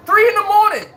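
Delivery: three in the morning, outraged shout; indignant outburst. Real, not acted. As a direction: fling it out in disbelief. Outraged at late-night hecklers.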